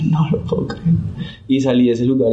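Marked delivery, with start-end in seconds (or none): 0.1-0.9 tearful disbelief. Retold or imitated. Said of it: "yo ya estaba llorando".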